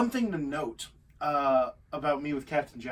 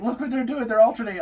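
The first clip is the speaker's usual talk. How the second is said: excited crowd quote; 7 semitones higher, 8 dB louder than usual. Retold or imitated. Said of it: "the people were like".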